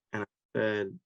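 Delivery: ordinary delivery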